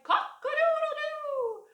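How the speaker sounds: high, loud rooster crow; full cartoon rooster. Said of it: "who cried".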